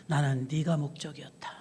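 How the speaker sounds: soft, low and gentle; a quiet, loving answer; tender voice of God. Acted. God gently telling a tearful Moses he was the goal.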